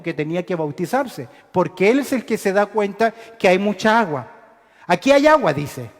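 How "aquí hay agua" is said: excited exclamation. Acted. Eunuch spots water, exclaims.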